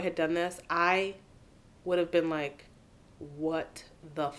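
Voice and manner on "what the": soft, slow stunned mutter; mock appalled. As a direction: let each word drop quietly, aghast. Acting out her own mortified reaction.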